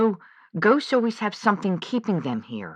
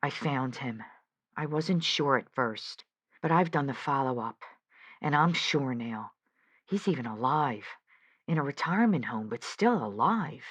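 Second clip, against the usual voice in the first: hushed and much softer, almost to herself; quiet confession; 6 semitones lower, 7 dB quieter than usual. Acted. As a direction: confess it quietly, afraid of the answer. Confessing she found the son.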